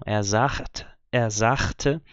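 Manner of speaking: "sagt" and "sagte" with ch for g; regional sagt. Shown as a demonstration. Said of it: "é uma pronúncia regional".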